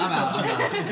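loud, high, laughing declaration; mock fed-up announcement. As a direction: play a baby storming out, loudly. Joking quote of himself as baby.

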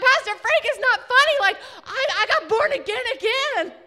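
almost shout your joy; bursting with excitement; excited protest. Retold or imitated.

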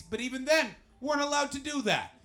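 high, loud exasperated punchline; loud comic disbelief; emphatic incredulity. Real, not acted. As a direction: raise pitch and volume to drive it home. Incredulous punchline about blackface prank.

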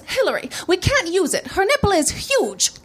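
high-pitched, appalled phone voice; mock self-important fashion editor; magazine staffer on phone. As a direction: play up the scandal in a high voice. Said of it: "like the one I overheard".

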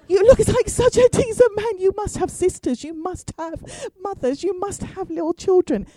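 high-pitched mock pleading; begging. Woman begs a gunman for her life, high hammed-up pleading. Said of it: "I'm begging him".

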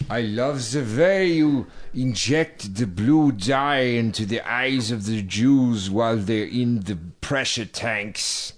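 hammed-up German accent; mock German accent. Co-host pretending to be the Nazi guard, hammed-up German accent.

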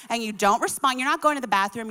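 ordinary talk

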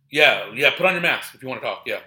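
sharp comeback. Retold or imitated.

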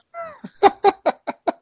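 a long high shriek of laughter. Real, not acted. Friend shrieks with laughter at the chocolate milk joke. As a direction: let a high laugh ring out and fall.

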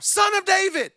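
high, loud call out; shouted plea. Acted. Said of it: "he shouted all the more".

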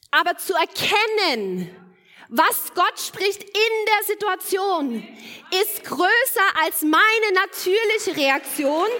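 slow, loud, rising preacher's climax; preaching at full force. Acted. Preacher to grieving congregation about her sick husband, fervent and slow. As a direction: drive each word home to the congregation.

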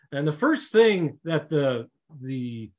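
usual speaking voice

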